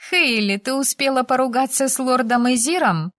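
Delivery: put-on high-pitched girl's amazed question; astonished. A narrator's reading. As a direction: ask it in high-voiced astonishment. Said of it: "удивилась Пенелопа". (изумлённо, высоким девичьим голосом)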